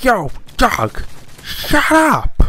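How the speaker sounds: high-pitched put-on scolding of a dog; mock irritated yell. Someone annoyed by a barking dog, mock yelling.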